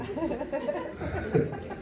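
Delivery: a soft, high falling laugh; a quiet high chuckle that falls away. Real, not acted. Preacher chuckling about the wife who prays.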